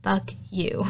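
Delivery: the curse repeated in a hushed whisper; soft muttered insult; whispered fuck you. Retold or imitated. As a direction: hiss it under your breath, half-mocking. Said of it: "and Eddie goes".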